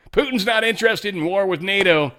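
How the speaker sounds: high, loud mock proclamation; hammed-up joking shout; parody outcry. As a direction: blurt it out high and loud as a joke. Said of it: "I was parody".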